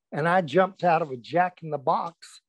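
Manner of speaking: normal talking voice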